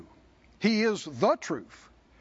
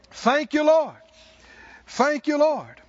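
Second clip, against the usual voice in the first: high, loud thanks to God; fervent shouted thanksgiving; shouted praise; 6 semitones higher, 5 dB louder than usual. Real, not acted. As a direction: cry out the praise.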